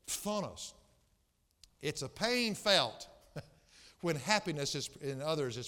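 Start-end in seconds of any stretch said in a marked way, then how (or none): 0.1-0.7 "Phthonos" with its opening cluster spat out; careful, spitty demonstration of "Phthonos"; spitting Greek pronunciation. Shown as a demonstration. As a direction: start "Phthonos" as though about to spit. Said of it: "like you're gonna spit".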